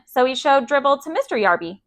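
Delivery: ordinary delivery, nothing marked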